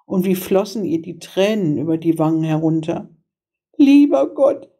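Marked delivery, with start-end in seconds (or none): 3.8-4.7 high, louder plea for help; pleading girl's voice, pitched high; desperate cry. A narrator's reading. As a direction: cry out as a despairing little girl. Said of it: "rief sie aus".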